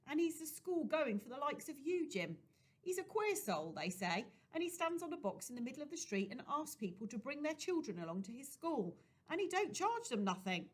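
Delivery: Betsy offering a plan; lightly voiced Betsy. A narrator's reading.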